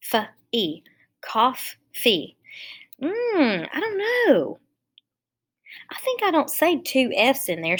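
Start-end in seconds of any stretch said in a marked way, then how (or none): 3.0-7.8 high, unsure hmm and I don't know; wondering aloud, higher; puzzled doubt. Real, not acted. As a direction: rise high in puzzled hesitation. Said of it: "doubting her own split".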